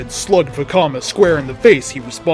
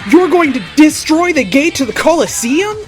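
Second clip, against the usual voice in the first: much higher screamed question; high-pitched scream; 11 semitones higher, 4 dB louder than usual. Acted. Teammate horrified at the plan, screaming. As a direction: shriek it in a put-on high voice. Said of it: "Mattel screamed".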